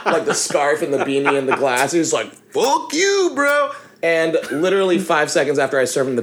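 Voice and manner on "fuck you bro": high, hammed-up angry "Fuck you, bro"; whiny outraged bro; caricatured angry retort; mock angry bro. Served band member lashing out.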